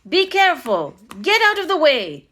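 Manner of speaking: high, loud warning shout; shouting policeman. A narrator's reading. Policeman to crowd on sidewalks, shouting. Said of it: "policeman shouted".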